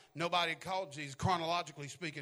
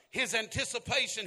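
ordinary delivery

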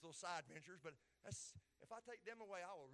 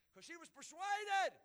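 high, loud answer; emphatic preaching shout; 11 semitones higher, 12 dB louder, slower than usual. Real, not acted. Preacher explaining why her house stood, emphatic shout. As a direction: cry out the reason.

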